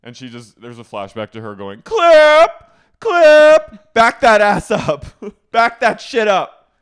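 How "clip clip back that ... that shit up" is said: very high, full-volume holler; mock drunken-diva yell. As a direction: bellow each phrase slowly, imitating a wild outburst. Imitating flashback of Dorinda yelling.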